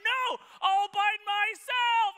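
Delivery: put-on very slow, high, loud child's insisting; stubborn toddler. Toddler refusing help with his seat, slow high insistence. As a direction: stretch each word like a defiant two-year-old.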